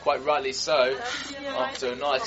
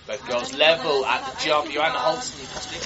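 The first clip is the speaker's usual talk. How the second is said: ordinary talk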